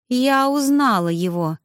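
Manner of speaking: ordinary delivery, nothing marked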